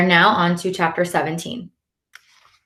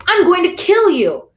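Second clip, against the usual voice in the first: high, loud threat that carries; angry brother shouting; 12 semitones higher, 5 dB louder than usual. A narrator's reading. Brother yelling down the street at Ali, angry shout. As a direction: yell furiously down the street. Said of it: "voice carries down our street".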